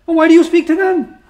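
high, loud mocking question; acted exasperated disbelief; incredulous retort. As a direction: throw the question back at them, voice leaping up. Acting Ibrahim's incredulous retort.

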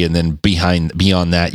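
ordinary talk

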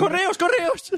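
very high, squeaky chant; playful high-pitched calling out; mock urgent call. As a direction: pipe it up high to hurry things along.

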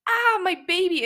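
hammed-up high wail; loud, theatrical cry of distress; mock scream. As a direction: play up the melodrama, high and loud. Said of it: "She was screaming".

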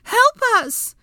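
very high, loud cry for help; shout. A narrator's reading. Hermione shouting up the opening for rescue, high shout. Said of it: "then shouted".

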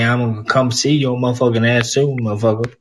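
brash put-on American accent. Scot pretends he will come round, mock American accent. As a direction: threaten him jokingly in mock American. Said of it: "When I do an American".

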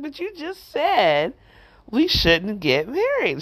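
high, slow, loud incredulous protest; you can't believe what she just said; shocked disbelief. Retold or imitated. Shocked reply to counselor.

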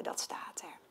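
breathy hushed aside; soft whispered remark. Real, not acted. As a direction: whisper it as a secret. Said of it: "whispered aside".

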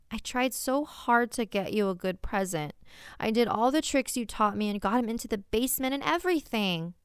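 pouting. Acted. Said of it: "I pout".

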